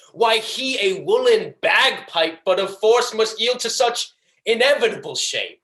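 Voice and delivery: gloating courtroom argument, rising high and insistent; Shylock. Acted. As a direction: turn the screw, building in pitch with relish. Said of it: "hatred and pleasure that I tried to deliver this monologue".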